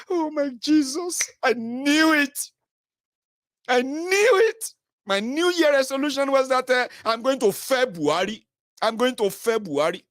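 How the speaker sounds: high, slow gushing excitement; mock gushing fan. Mocking a fan's resolution, high slow gushing. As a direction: swoon over the news.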